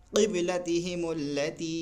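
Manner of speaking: قبلتہم اللتی chanted slowly; measured chant; slow recitation. Shown as a demonstration. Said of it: "پڑھیں گے".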